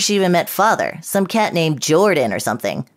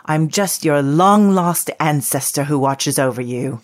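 put-on English accent. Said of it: "With this stupid accent".

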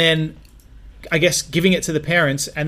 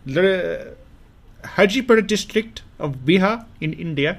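Indian accent; 3 semitones higher than usual. Shown as a demonstration. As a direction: place names in an Indian accent. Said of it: "say that in a Indian accent".

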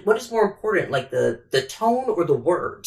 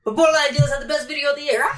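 higher, faster, cocky put-on voice; brash mock interruption; 11 semitones higher, faster than usual. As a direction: barge in like a showman stealing the mic. Meme impression, playful interruption.

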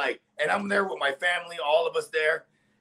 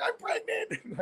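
much higher, softer, slowed teary wail with a laugh; mock crying; 8 dB quieter, much slower than usual. Girlfriend finally admits the pregnancy, in mock tearful high voice. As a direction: imitate her breaking down, half laughing. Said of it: "She's like crying".

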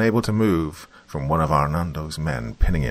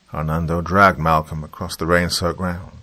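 usual speaking voice

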